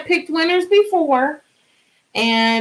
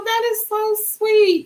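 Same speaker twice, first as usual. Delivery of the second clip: high gushing; 11 semitones higher, slower than usual. Real, not acted. Repeats the same reaction.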